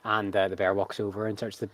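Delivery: usual speaking voice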